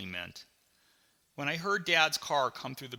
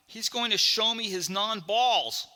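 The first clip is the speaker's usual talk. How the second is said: high, loud, slow realization; alarmed disbelief; 9 semitones higher, 6 dB louder than usual. Acted. Boy realizing what dad will show him, loudly aghast. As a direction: stretch it out in mounting horror. Boy's horrified thought about dad.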